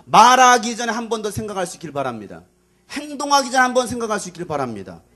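high, loud, urgent preaching; full-force exhortation. Real, not acted. Preacher urging listeners to think before acting, forceful. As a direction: press the warning hard.

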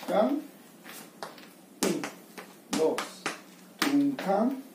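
trucutum drawn out slow; slow rhythm demo. Shown as a demonstration. Teacher slowly voicing the zapateo step for the student. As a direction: spell out "trucutum" slowly, syllable by syllable.

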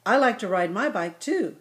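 usual speaking voice

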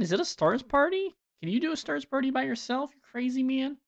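high-pitched playful disbelief; high excited teasing. Pretend. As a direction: squeal it at a crazy friend. Teasing a donor with gifts.

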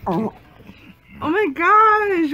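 ordinary talk, nothing marked

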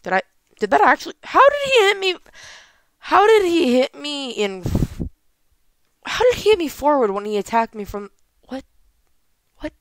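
outraged disbelief, pitch jumping high; loud, rising indignant questioning; raging gamer. Real, not acted. Disbelief at an unfair hit.

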